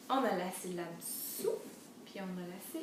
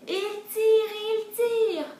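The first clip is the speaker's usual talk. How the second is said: high, loud storytelling chant; loud, raised singsong pulling; straining pull refrain; 11 semitones higher, 11 dB louder, slower than usual. A narrator's reading. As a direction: heave on every tire.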